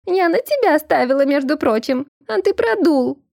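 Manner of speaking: high, squeaky reproach; nasty falsetto nagging; squeaky woman. A narrator's reading. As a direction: complain in a high put-on woman's voice. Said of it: "писклявый женский голос из чаши".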